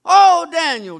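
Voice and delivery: high, loud desperate cry; frantic calling. Acted. Frantic king calling down to Daniel in the den, loud cries. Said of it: "the king's up there all frantic".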